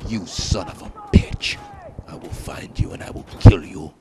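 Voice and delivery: low, rasped menacing threat; hammed-up revenge vow, low and hoarse; mock movie threat. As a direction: growl it like a vengeful action hero. Movie-style threat at rival player.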